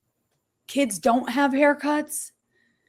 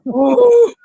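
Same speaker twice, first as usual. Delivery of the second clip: laughing ooh; 8 semitones higher, 9 dB louder than usual. Real, not acted. Laughing at a bad haircut.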